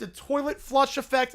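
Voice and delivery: usual speaking voice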